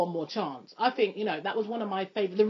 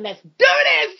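hyped-up shout; 9 semitones higher, 10 dB louder than usual. Real, not acted. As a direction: shout it to kick off the performance. Hyping up the start of rapping.

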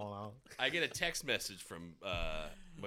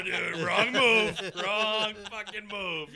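slow, loud, higher cry of regret; mock alarmed shout; 11 semitones higher, 9 dB louder, much slower than usual. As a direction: drag out each word like you just realized your mistake. Imagined regret at the bar.

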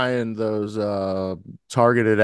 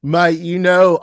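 loud, high Aussie bloke hail; Australian accent, bursting in loud and high; 8 semitones higher, 7 dB louder than usual. Acted. Co-host asks for a foreign persona, loud Australian accent. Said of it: "I've done Australian on the show".

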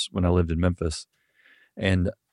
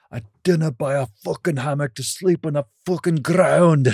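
high, cursing Scottish rant building louder; angry Scottish accent; 8 semitones higher, 4 dB louder than usual. Acted. Scottish guy swearing inside a frustrated camper's head, angry Scottish accent. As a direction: rage like a sweary Scotsman, pitched up high. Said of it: "Scottish guy saying".

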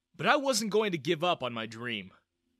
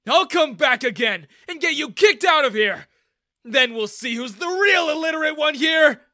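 loud, high vow of revenge; furious shout; 13 semitones higher, 14 dB louder than usual. Acted. Girl threatens Daniel while dragged away, shouting. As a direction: shout the threat back at him.

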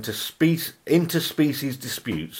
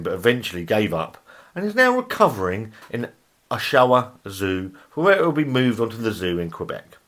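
ordinary delivery

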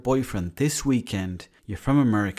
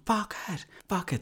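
imitated shouting; 9 semitones higher, 4 dB quieter than usual. Brother losing at the game late at night, imitated shouting. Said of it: "he started shouting, going".

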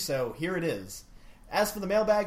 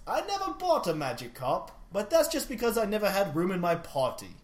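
put-on improvised Belgian accent with lilting pitch; makeshift Belgian accent. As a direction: read it in a badly faked Belgian accent. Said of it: "Do it in a Belgian accent".

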